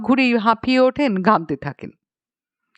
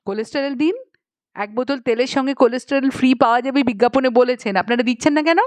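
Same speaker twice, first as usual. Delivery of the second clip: rapid, indignant customer complaint; insistent demand; 2 semitones higher, faster than usual. Acted. Lady customer demanding free cholesterol as advertised, insistent. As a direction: demand it in a quick rush. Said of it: "মহিলা বিজ্ঞাপন অনুসারে দাবি করছেন".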